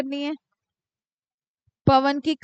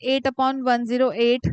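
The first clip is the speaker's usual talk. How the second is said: slow working aloud; 3 semitones lower, much slower than usual. Shown as a demonstration. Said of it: "तो अब आप क्या करोगे".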